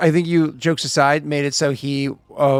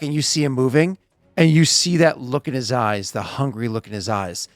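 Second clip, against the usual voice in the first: ordinary talk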